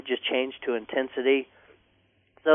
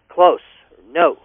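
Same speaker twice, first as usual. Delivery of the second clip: "close or no" clipped and louder; short and sharp; 7 dB louder than usual. Shown as a demonstration. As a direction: bark the commands short. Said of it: "short and sharp".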